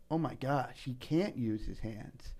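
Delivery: soft, low, slowing mock dismay; quietly imitated mortified thought; hushed realisation. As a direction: voice her dawning horror under the breath. Retold woman's horrified realisation.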